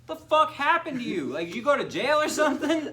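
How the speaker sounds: very high, loud, incredulous yelling; hammed-up squawk of astonishment; mock shocked outburst. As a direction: imitate someone demanding answers in disbelief. Acting out shocked man's questions.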